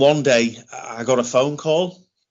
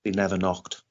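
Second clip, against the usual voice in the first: quiet bitter stress; 5 semitones lower, 7 dB quieter than usual. Real, not acted. Bitter repeated point about neglect.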